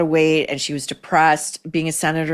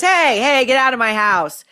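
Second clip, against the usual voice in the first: joking; 5 semitones higher, 3 dB louder than usual. Retold or imitated. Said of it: "tries to diffuse it by joking".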